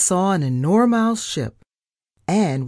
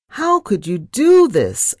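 loud, higher-pitched narrated wail; 6 semitones higher, 7 dB louder than usual. A narrator's reading. Geronimo protests to Pinky about the trip, wailing. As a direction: wail it in dismay, voice raised. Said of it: "I wailed".

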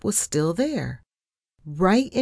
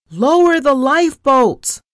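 high, loud urgent command; Captain Nelson shouting; 10 semitones higher, 9 dB louder than usual. A narrator's reading. Captain to crew as the iceberg nears, shouting. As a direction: shout the order across a ship. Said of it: "I heard Captain Nelson shout".

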